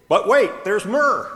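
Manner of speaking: high, loud mock TV-ad hype; infomercial pitch. Pastor reading his shirt's joke like an ad pitch. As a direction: sell it like a late-night ad.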